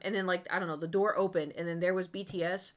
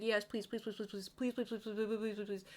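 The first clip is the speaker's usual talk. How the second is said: rapid pleading that trails softer; pleading fading; 2 semitones higher, 5 dB quieter than usual. Retold or imitated. Begging the woman to be a fanatic, fading plea. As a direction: beg on and on, fading out.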